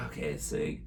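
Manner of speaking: ordinary talk, nothing marked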